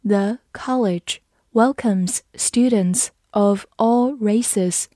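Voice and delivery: slow, word-by-word model reading; slow read-along. Shown as a demonstration. Teacher reading for learners to follow, slow and measured. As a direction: read slowly with small gaps between words. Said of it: "好，跟着我慢读一遍".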